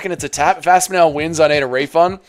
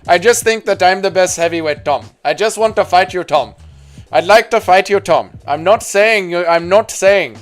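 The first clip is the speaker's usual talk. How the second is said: raised, louder Indian-accented fighter impression; mock Indian accent; 4 semitones higher, 5 dB louder than usual. Streamer reprising the fighter impression for chat, Indian accent. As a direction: put on the Indian accent, pitched up. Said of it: "The Jeet Aspinall impression was hilarious".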